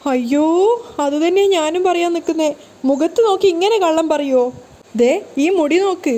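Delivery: high, put-on lament; aggrieved protest. A narrator's reading. Kanaka shows her pulled hair, high aggrieved protest. Voiced accuser protesting tearfully.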